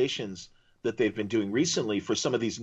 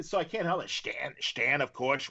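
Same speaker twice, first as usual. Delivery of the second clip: normal talking voice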